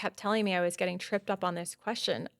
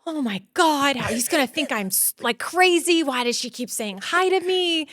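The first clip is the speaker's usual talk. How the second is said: panicked inner thought; 5 semitones higher, 4 dB louder than usual. Retold or imitated. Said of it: "And I was like".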